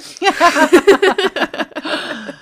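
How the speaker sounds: a burst of loud high laughter; big bright laugh after the aside. Real, not acted.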